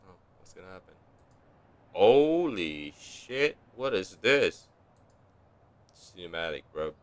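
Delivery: ordinary delivery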